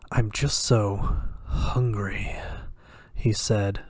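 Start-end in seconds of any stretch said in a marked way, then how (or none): 0.1-2.3 slow, breathy hushed whine; petulant teasing whisper. A narrator's reading. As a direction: tease him in a slow whisper. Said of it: "both petulant and teasing".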